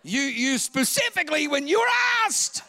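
high, loud accusing shout rising; wife's indignant shout. Retold or imitated. Wife scolding him over stolen fries, shouting. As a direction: yell it like a wife catching him.

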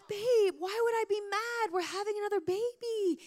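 retold husband's high-pitched excitement; 激动安慰.

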